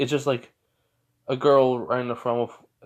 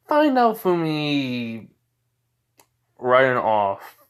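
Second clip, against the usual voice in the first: ordinary delivery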